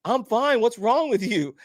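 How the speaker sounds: high-pitched, baffled brush-off; exasperated. Retold or imitated. Retold wife dismissing panicked husband.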